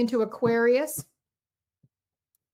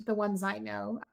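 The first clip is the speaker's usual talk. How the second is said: ordinary delivery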